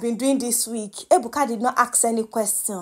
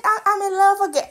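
high, quick mock of her changed accent; mimicking Wani's accent; 9 semitones higher, faster than usual. Vlogger mocking Wani's new accent on her love confession, high and quick. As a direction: tease the lovestruck put-on accent. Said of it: "Abeg, did you hear that accent?".

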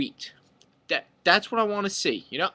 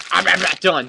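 mock loud protest; 7 dB louder than usual. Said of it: "You're just".

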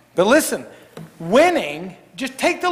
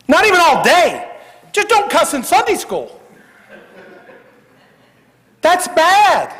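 emphatic preaching; 8 semitones higher, 5 dB louder than usual. Real, not acted.

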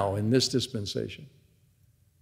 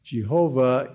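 normal talking voice

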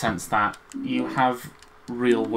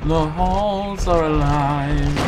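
spooky drawn-out line; 5 semitones higher, 5 dB louder, much slower than usual. Pretend. Spooky echo of partner.

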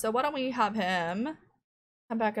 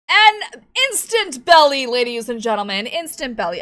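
showman announcement; 2 semitones higher, 13 dB louder than usual. Acted. Gamer presenting the Sim's instant belly to viewers, hammed-up. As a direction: announce it like a circus act.

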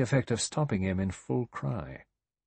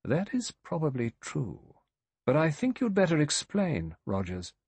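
dry; 7 semitones higher than usual. A narrator's reading. Judge tells Rogers he had better explain, dryly. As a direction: pronounce it dryly and deliberately. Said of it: "The judge said dryly".

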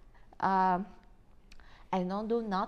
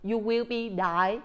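ordinary talk, nothing marked

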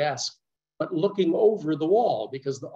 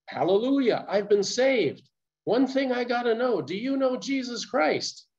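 excited; 8 semitones higher than usual. Retold or imitated. Rescued stranger rejoices.

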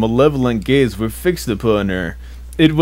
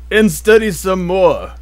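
slow, louder, dragged-out ending; slow mock-dramatic storybook emphasis; drawn-out emphasis; 5 semitones higher, 4 dB louder than usual. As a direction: stretch it out for comic weight. Dramatic reading of repetition.

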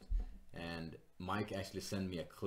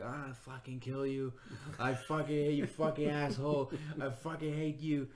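mock angry screaming; 6 semitones higher than usual. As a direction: spit out her hate in a shrill mock yell. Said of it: "grabbed the microphone and started going".

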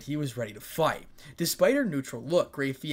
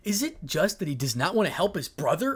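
raised, accusing question; higher and louder, with a glare; glaring; 5 semitones higher, 5 dB louder than usual. A narrator's reading. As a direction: demand it disapprovingly. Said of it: "spoke with a glare".

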